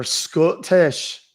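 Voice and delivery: drawn-out Scottish pronunciation; Scottish accent. Shown as a demonstration. Streamer naming an accent he does, broad Scottish accent. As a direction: lean hard into the Scottish. Names accent while doing it.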